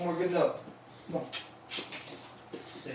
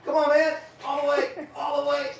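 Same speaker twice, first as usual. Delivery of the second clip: higher, louder pushing "come on, man"; eager urging; 9 semitones higher, 5 dB louder than usual. Real, not acted. Trainer cheering his dog through the trick, excited.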